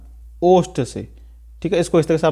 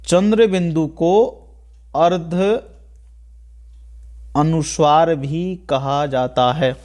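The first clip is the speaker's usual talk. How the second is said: slow, spaced out with long pauses; slow dictation; slower than usual. Real, not acted. Teacher giving students a line to note down, slow dictation. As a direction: dictate phrase by phrase for note-taking.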